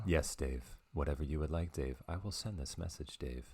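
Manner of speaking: lightly voiced calm computer, soft and even; a hint of HAL 9000, quiet and polite. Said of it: "Can you do a good Hal?".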